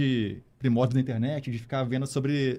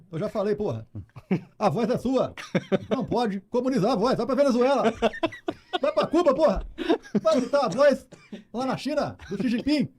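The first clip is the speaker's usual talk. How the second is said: high, laughing, ranting Bolsonaro voice; Bolsonaro impression; 8 semitones higher than usual. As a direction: rant like Bolsonaro, mid-laugh. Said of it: "Faz o Lula e o Bolsonaro aí". (imitação do Bolsonaro)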